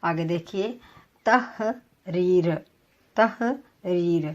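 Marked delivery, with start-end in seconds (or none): 1.2-4.2 تحریر very slow, tah-reer, silent he; slow demo word. Shown as a demonstration. Teacher modelling تحریر slowly for students. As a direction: stretch تحریر out slowly twice. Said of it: "تے کے اوپر زبر، ہی ساکن".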